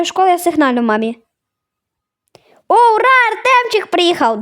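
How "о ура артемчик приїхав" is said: lightly voiced cheerful mom, pitched higher; mom's voice. Mom in the car greeting Artemchyk, lightly voiced and cheerful. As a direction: play mom happily greeting her son.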